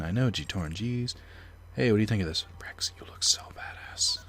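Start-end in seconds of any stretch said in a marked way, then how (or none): 2.6-4.2 slow admiring whisper. Real, not acted. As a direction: breathe it out, impressed. Aside to partner during cutscene.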